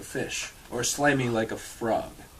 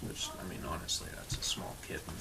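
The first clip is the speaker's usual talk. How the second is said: whispered aside, much softer; 9 semitones lower, 14 dB quieter, faster than usual. Real, not acted. Reader whispering an aside to friends about the kitten.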